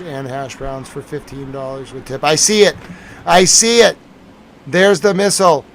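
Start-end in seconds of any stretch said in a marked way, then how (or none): 2.2-5.6 louder, higher, eager shout; real excitement at finally seeing it; excited shout. Real, not acted. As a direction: call it out the moment you spot it. Spots the missile on camera.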